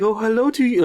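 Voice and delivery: high, sing-song mock greeting; talking to a dog. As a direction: coo at the dog like a gushing stranger. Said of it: "she talks to the dog".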